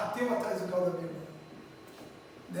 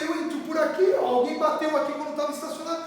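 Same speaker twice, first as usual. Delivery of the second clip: insistent lie; 5 semitones higher, 7 dB louder than usual. Pretend. Lying to father, insisting.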